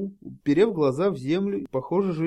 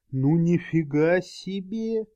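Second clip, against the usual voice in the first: amazed gasp; 3 semitones higher, 3 dB louder, much slower than usual. A narrator's reading. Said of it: "выдохнула толпа".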